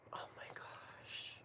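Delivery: slow, hushed, awed whisper. Real, not acted. Vlogger smelling incense, whispering in awe. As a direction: breathe it out in wonder.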